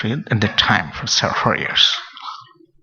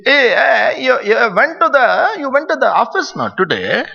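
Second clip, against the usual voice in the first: put-on Indian father's accent; 12 semitones higher than usual. Father questioning child about the office, high Indian accent. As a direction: imitate a father's thick Indian accent, pitched way up. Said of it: "father, totally Indian accent".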